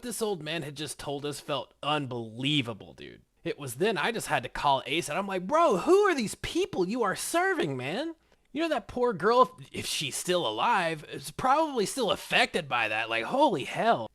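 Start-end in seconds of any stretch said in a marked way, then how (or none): 5.5-14.1 retold, higher and louder, aghast; half-acted disbelief at his friend; exasperated rant. Retelling to viewers what he told Ace, louder and appalled.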